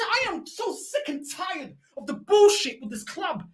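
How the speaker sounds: ordinary talk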